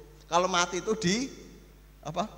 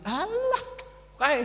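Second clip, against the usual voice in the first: high scornful sneer, then a laugh; mock contempt for the deceased; dismissive scoff; slower than usual. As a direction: scoff at the dead man. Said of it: "Andai kata kita mati".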